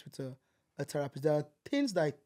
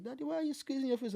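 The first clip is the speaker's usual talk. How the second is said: put-on child's question; 9 semitones higher, 6 dB quieter than usual. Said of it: "and I'm like".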